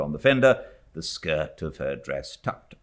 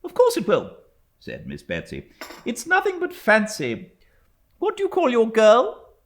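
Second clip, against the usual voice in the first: higher, louder, brisk dismissal; brusque old aunt; 7 dB louder than usual. A narrator's reading. Stern aunt hushing frightened niece, brusque. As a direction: wave the fuss away.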